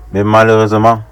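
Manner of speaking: ordinary delivery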